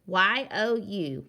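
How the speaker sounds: Y O U spelled letter by letter, slowly; teacher spelling a sight word. Shown as a demonstration. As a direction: spell it out clearly. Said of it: "They can run to you".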